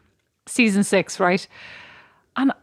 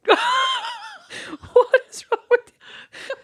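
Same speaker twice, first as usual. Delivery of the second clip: very high, slow, laughing shout; hammed-up fed-up kidnapper; mock exasperated yell; much slower than usual. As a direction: scream it at the hostage through laughter. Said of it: "They'd be like".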